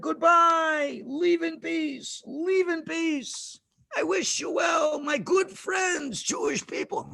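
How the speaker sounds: high, drawn-out sugary goodbye; smiling farewell. Acted. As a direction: wave them off with a big audible smile. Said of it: "with a big smile on his face".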